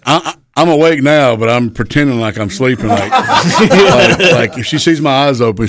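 normal talking voice

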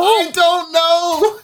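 high, drawn-out helpless wail; exasperated bafflement. Real, not acted. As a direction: stretch it out high, at a total loss. Exasperated answer to baffled question.

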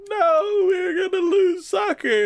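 very high fake-crying whine; hammed-up tearful squeal; mock wailing team. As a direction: bawl it like a cartoon crybaby. Voicing the crying losing team.

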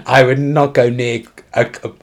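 normal talking voice

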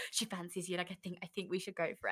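whispered egging on, very soft; hushed scheming. Retold or imitated. Friend whispering encouragement to text the girl, very soft. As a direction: hush it like plotting in secret.

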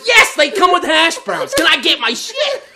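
high, loud and fed up, laughing; snapping back, barely holding it together; losing patience. Retold or imitated. Snapping at the worker over the hash browns, fed up. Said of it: "I'm starting to lose my shit".

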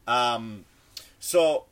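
ordinary delivery

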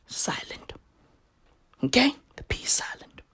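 whispered, unvoiced. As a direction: whisper it as if it were silent. Joke about silent P.

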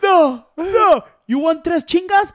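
high, loud mocking voice; mocking Julian. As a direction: sneer it up high like a taunting friend.

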